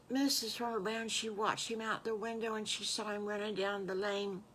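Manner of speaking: plain reading voice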